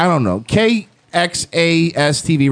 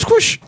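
squish noise. Retold or imitated. Mimicking squirting milk sound.

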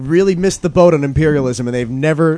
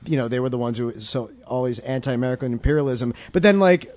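ordinary delivery

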